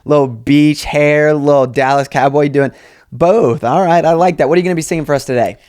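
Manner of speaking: loud, gushing celebrity judge; a judge flattering a hopeful singer. Pretend. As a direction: hype the contestant up. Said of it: "I'll be, like, [name]".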